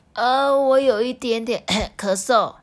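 put-on high boy voice with a cough; coughing child. A narrator's reading. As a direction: admit it with a cough. (边咳边说的小孩)

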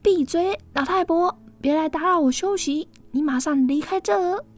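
angry outburst. Acted. Tina furious at the old woman disturbing her rest. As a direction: shriek it at the nagging old woman. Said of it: "非常生气了".

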